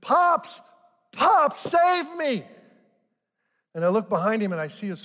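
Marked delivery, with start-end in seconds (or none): 0.0-2.4 put-on high, loud child's cry for help; drawn-out, high-pitched yell to be rescued; mock scream. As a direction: scream for grandpa like a panicked kid. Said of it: "and he screams".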